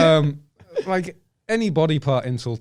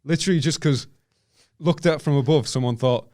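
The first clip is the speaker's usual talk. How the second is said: usual speaking voice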